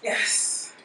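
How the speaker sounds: ordinary delivery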